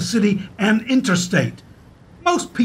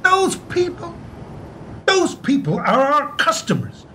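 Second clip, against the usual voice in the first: pitched up high, voice cracking with indignation; outraged, choked up; 7 semitones higher than usual. Acted. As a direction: throw "those people" back at him, close to tears. Peter outraged at the bank's contempt.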